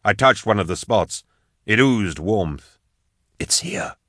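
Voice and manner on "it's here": breathless unvoiced whisper. A narrator's reading. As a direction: whisper it so the beast can't hear. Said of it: "I whispered".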